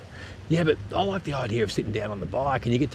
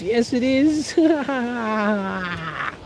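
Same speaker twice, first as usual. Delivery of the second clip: much higher, laughing yes it is; high excited cheer; 11 semitones higher, much slower than usual. Real, not acted. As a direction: squeal with delight at the rivalry. Cheering West is the best.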